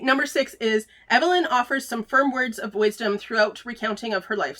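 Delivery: ordinary talk, nothing marked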